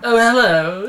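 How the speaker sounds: high, loud, pleasantly surprised hello; different, aroused tone. A narrator's reading. Man touched in the shower, suggestively surprised. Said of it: "this time in a different tone".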